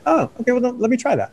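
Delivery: high-pitched voice of a fresh realization; acted-out lift of reconsidering; dawning willingness. Voicing a person rethinking, newly willing.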